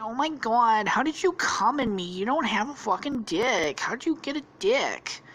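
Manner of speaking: put-on high-pitched girly voice, aghast; hammed-up feminine disbelief; mock shocked woman. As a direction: play a woman demanding an explanation. Acted shocked woman's outburst.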